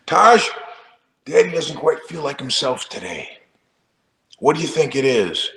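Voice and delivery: a hint of a solemn dad, opening high; pretending to be Taj's dad to prank him; mock fatherly.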